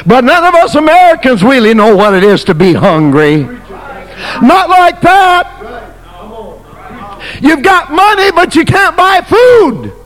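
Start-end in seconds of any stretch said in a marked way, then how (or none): 4.4-9.9 high, shouted preacher's cry; fiery pulpit shout; full-force preaching. Acted. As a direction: hammer the point home. Preaching peak on famine.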